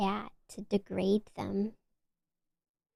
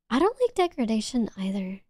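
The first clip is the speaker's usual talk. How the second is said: usual speaking voice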